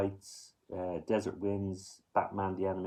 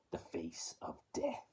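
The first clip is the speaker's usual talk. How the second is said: breathy horror-title whisper, much softer; spooky whisper; 2 semitones lower, 9 dB quieter than usual. Acted. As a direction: whisper the title like a ghost story.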